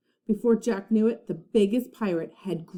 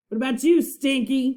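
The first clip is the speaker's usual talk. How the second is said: louder pirate call; pirate roar; 5 semitones higher, 5 dB louder than usual. A narrator's reading. Captain Bones roaring up at Stinky, loud pirate call. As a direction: roar up at the crewman. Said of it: "Captain Bones roared".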